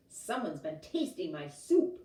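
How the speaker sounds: loud, booming bear roar; roaring Papa Bear. A narrator's reading. Papa Bear roaring at his spilled soup, loud. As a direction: roar it like a big angry bear. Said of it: "he roared".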